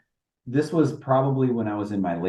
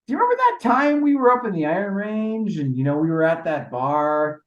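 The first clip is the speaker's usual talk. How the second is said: yelling to the dugout; 6 semitones higher than usual. Retold or imitated. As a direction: shout it over to your buddy on the bench. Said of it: "I yelled in my dugout".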